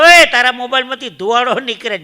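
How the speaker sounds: loud, high alarmed shout; alarmed call. Retold or imitated. Speaker shouting at a man whose phone smokes.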